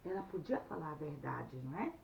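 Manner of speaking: ordinary delivery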